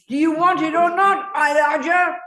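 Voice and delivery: loud, high pointed question. Real, not acted. Teacher pushing a hesitant student to commit, loud and demanding. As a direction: corner him with a sharp loud question.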